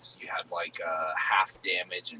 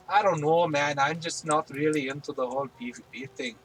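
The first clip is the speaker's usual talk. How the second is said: a lightly put-on Scottish accent, a touch higher; Scottish accent attempt; 4 semitones higher, 3 dB louder than usual. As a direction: slip into a half-hearted Scottish accent. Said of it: "I stick with an accent for a sentence".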